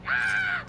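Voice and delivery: very high mock scream; imitated shriek. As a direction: shriek like the possessed friend. Friend screams during mock exorcism.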